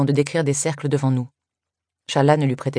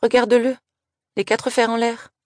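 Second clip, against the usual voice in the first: gleeful; 10 semitones higher than usual. Acted. Girl laughing at fallen boy.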